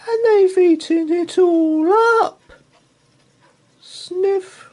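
very high, loud squeak; squeaky Baby Bear. Acted. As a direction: squeak like a tiny upset cub. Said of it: "squeaked Baby Bear".

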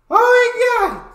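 stretched high shriek of shock; shocked shout. Real, not acted. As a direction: scream it out slow. Reactor's exaggerated exclamation.

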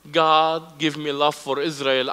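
ordinary delivery

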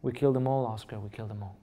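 put-on quietly echoing the old man's tears; a hint of a weeping old missionary, hushed; retold crying. Old missionary confessing to the young preacher, hushed and tearful. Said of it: "he started to cry and said".

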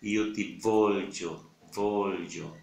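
Io ti voglio very slowly, the gli in voglio held. Shown as a demonstration. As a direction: drag out voglio, stressing the gli. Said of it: "pronunciando la G L I". (io ti voglio muy lento, con la gli marcada)

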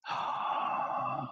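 long audible inhale; deep breath. Acted. Olivia before wishing for sunshine, big deep breath. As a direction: draw in a big, slow breath. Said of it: "she took a deep breath".

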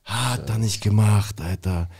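slow, stretched-out disbelief; low, slow retold incredulity; drawn-out disbelief. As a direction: drag the words out, stunned. Disbelief at colleague's blunder, repeated.

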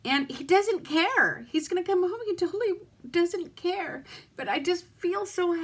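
weeping over his kindness; crying. Real, not acted. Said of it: "sit here and cry on camera".

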